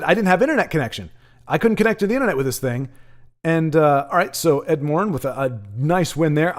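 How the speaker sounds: normal talking voice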